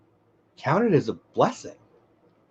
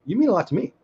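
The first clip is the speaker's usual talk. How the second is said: high excited voice; 7 semitones higher than usual. Real, not acted. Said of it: "my voice gets high".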